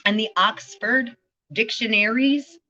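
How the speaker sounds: ordinary talk, nothing marked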